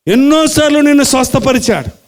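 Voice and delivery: normal talking voice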